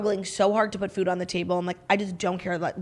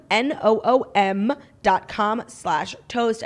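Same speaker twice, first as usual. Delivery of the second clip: each letter spelled out slowly and clearly; slow spelled URL; much slower than usual. Acted. Ad host spelling the web address slowly for listeners.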